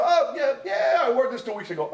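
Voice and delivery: imitated careless guy. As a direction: play a guy casually finding old clothes. Boy picks clothes off the floor.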